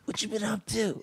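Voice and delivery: high, hushed, breezy buddy greeting; casual greeting. Retold or imitated. Friend greeting the teller mid-act, hushed and breezy. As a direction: toss off a lazy hello mid-distraction.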